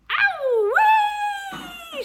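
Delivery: very high, loud squawking shriek; a startled hen yelping in pain; hen's shriek. Acted. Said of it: "shrieked the hen".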